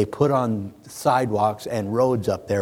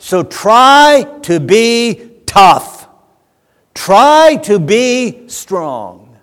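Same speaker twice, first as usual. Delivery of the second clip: loud, much higher cry to a hurt son; impassioned father; 8 semitones higher, 10 dB louder, slower than usual. Retold or imitated. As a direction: call it out, slowing hugely at the end. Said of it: "Here's what I was saying".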